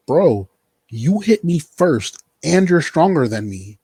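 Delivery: loud, high, incredulous retort; indignant comeback. Retold or imitated. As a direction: protest like a wronged bro, stretching the words. Retells Angel's indignant comeback.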